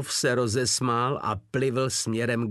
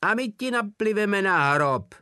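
much higher and louder threat; threatening shout; 11 semitones higher, 6 dB louder than usual. A narrator's reading. Drobeček threatening Wolf from the barracks, shouting. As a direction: yell it out of the dark barracks. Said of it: "zahrozil z temnoty ubikace Drobeček".

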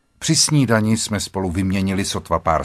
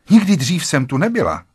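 raised pitch, uneasy denial; faltering denial; 6 semitones higher, 4 dB louder than usual. A narrator's reading. Wife denies to her husband being there before, faltering. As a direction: deny it with a voice that jumps up a little. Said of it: "Vždyť ti přeskakuje hlas".

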